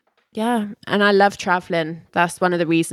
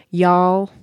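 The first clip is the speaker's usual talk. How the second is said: Texan y'all, said a touch louder; Texan accent; 4 dB louder than usual. Shown as a demonstration. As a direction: give a sample word in a Texan accent. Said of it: "You know any Texan words?".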